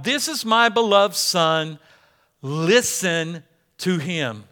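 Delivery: slow, weighty divine proclamation; voice from heaven. Acted. God speaking from the cloud to the disciples, voice from heaven. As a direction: proclaim it slowly and firmly. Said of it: "a voice from heaven comes down".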